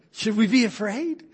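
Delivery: mock fear, playfully. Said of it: "mock me like you wouldn't believe".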